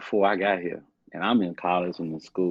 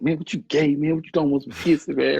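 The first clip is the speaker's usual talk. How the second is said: high, loud mocking voice of the father; mocking dad; 9 semitones higher, 5 dB louder than usual. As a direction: mimic dad teasing him off.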